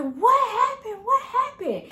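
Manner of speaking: re-enacted high, loud alarm; panicked mom. Mom runs in after the fall, high panicked cry. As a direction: cry it out as a mother rushing in.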